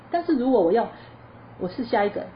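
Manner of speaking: ordinary delivery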